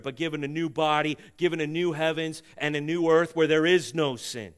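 loud, high preaching voice; rising sermon climax, loud and high; full-force preaching. Acted. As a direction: preach it out to the whole room.

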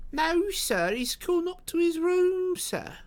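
very high, drawn-out landlady voice; Missus Hudson, deferential. Acted. Landlady answers lodger's friend, slow falsetto. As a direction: go falsetto and stretch the words.

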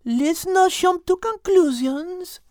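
slow, measured warning; a calm voice of reason; cautious Liz. Acted.

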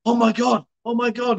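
mock shock; retold shocked oh my God. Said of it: "she was like".

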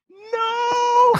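very high wailing cry; bawling no. Retold or imitated. Girl answering that her grandpa didn't know the Lord, wailing. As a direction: wail the word out in a burst of tears. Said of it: "starts bawling".

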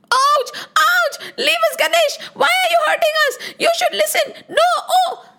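high pained yelling. Acted. Army knocked flat by Ganesh, yelling in pain. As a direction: cry out like soldiers knocked flat.

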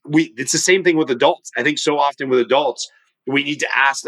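ordinary talk

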